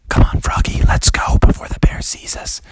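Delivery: forceful stage whisper; urgent whisper. Acted. Dad urges Froggy out before the bear sees, whispering. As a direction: whisper so the bear won't hear.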